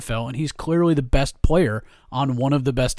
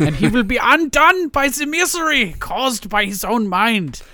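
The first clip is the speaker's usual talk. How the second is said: higher, slow, put-on German accent as Freud; Sigmund Freud; 8 semitones higher than usual. Co-host gets a hammed-up German Freud verdict on Brady. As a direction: deliver it like a theatrical German psychoanalyst. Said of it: "I slipped into a weird Sigmund Freud there".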